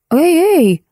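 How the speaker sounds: high, insistent interjection; acted attempt to halt someone; interrupting call. A narrator's reading. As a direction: cut in with a high-pitched protest. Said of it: "прервала я".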